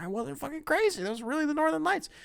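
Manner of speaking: high, gleeful I-told-you-so; vindicated excitement. Retold or imitated. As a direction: crow that you were right all along. Vindicated to girlfriend.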